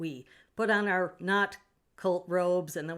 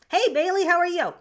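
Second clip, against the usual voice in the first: much higher and louder burst of hello; excited greeting; 12 semitones higher, 12 dB louder than usual. Real, not acted. Streamer suddenly greeting Bailey in chat, loud and high. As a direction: shout out as if spotting a friend.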